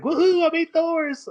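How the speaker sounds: high, loud gleeful whoop; imitated celebration, pitch shooting way up; mock excitement. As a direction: cheer it in hammed-up triumph. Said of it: "he wasn't excited about it".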